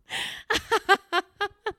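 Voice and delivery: high-pitched helpless giggles; real squealing run of laughter; laughing fit. Real, not acted. Host replaying their own blunder, high helpless laughing fit.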